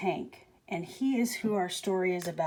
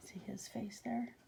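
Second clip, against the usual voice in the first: hushed aside; whisper; 16 dB quieter than usual. Real, not acted. Teacher whispering to the children about Hank's face, hushed.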